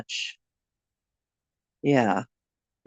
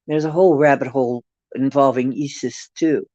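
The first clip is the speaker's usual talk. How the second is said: ordinary talk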